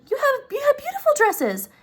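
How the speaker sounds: very high, pleading husband voice. Retold or imitated. Husband pleading with his wife about her dresses, high.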